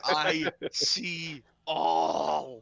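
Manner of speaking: slow, drawn-out eerie eye voice; homunculus voice. Acted. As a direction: speak like an all-seeing floating eye. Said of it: "just sitting there going".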